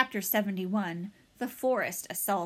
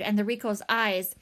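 usual speaking voice

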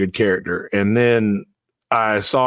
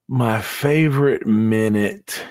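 ordinary talk, nothing marked

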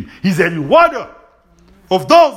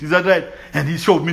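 ordinary talk

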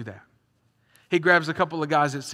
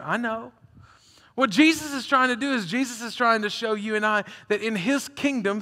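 normal talking voice

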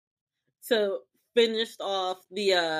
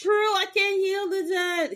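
Leo; 11 semitones higher than usual. Retold or imitated. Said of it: "Leo is just like".

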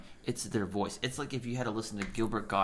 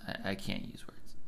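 whisper; 2 semitones lower, 8 dB quieter than usual. Real, not acted. Whispered joking exchange.

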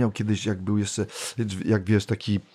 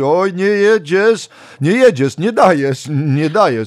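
higher, louder, slow at first; a judge sighing over a failed act; disappointed judge; 7 semitones higher, 6 dB louder, slower than usual. Retold or imitated. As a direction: let it droop in mock letdown. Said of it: "ona mówi".

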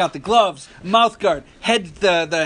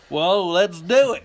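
drawl; 4 semitones higher, 5 dB louder, slower than usual. Retold or imitated. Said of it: "he had a drawl".

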